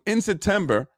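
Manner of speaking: normal talking voice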